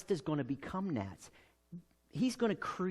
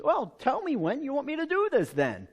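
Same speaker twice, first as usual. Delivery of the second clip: teasing Moses; 10 semitones higher, 4 dB louder than usual. Acted.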